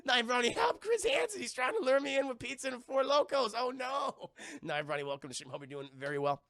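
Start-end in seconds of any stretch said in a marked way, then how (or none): 0.0-4.3 mock panic. Reading a donation message.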